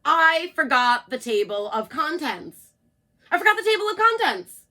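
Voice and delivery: loud, then high and fast flustered cry. Acted. Narrator Jack admits his mistake, flustered loud cry. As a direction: blurt it out in a growing panic. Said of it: "cried Jack the narrator".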